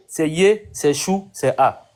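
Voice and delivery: "Yé", "Chou", "A" each set apart as its own beat, with a pause between; syllable by syllable. Shown as a demonstration. Host to guest, breaking Yeshua into its syllables "Yé", "Chou", "A". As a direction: spell out "Yé" then "Chou" then "A" slowly, one syllable at a time. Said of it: "Yeshua, c'est trois syllabes".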